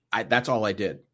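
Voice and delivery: normal talking voice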